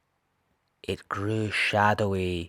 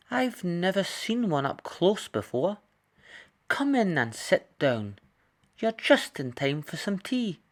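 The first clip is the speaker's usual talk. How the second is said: higher, curious kindly wolf; friendly wolf voice; 8 semitones higher than usual. Acted. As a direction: greet the child like a gentle old wolf. The last wolf welcomes Red.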